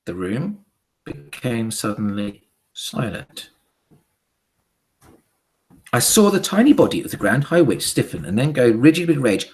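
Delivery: usual speaking voice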